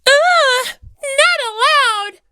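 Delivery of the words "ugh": startled ugh. Acted. Monami struggles in his arms.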